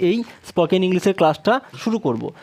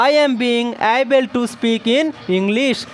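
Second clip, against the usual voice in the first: slow dictation; 2 semitones higher, much slower than usual. Shown as a demonstration. Teacher dictating the answer slowly.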